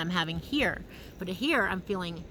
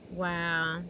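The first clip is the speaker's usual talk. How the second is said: usual speaking voice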